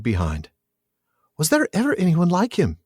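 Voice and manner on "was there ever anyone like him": high, wondering hobbit voice; Pippin's voice. A narrator's reading. Reader voices Pippin talking of Aragorn, high hobbit voice. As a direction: read it as a marvelling young hobbit. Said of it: "he said".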